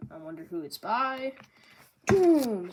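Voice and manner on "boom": a loud excited burst; hype boom. Real, not acted. Hyping Charlos getting kicked, loud burst. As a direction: slam it out.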